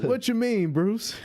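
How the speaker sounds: squeaky high-pitched Two-Face; high mock villain voice. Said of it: "It's like".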